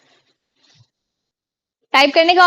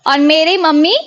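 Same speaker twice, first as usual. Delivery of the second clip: ordinary delivery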